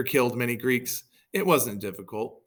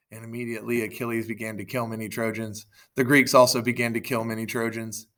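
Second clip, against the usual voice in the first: usual speaking voice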